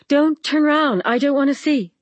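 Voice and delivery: shrill, shaking voice. A narrator's reading. Rosie pleads with her mirror self, shrill and shaking. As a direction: beg her not to turn, voice rising in fear. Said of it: "she added in a shrill, shaking voice".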